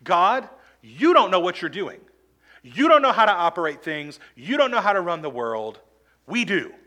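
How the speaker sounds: loud defiant scolding of God; arrogant complaint. Retold or imitated. Preacher voicing Israel's grumbling at God, loud and defiant. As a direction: throw it at God like you know better. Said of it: "what they're really saying is".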